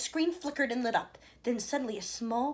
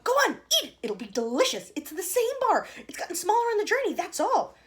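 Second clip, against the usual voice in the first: very high, loud delighted shout; excited shout; 11 semitones higher, 9 dB louder than usual. A narrator's reading. Wonka shouting at Charlie to eat the chocolate, delighted. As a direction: cheer him on to taste it.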